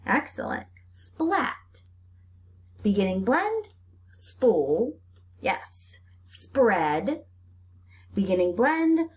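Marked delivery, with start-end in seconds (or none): none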